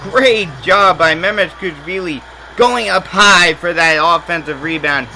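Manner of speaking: high, loud and-one call; excited call. Real, not acted.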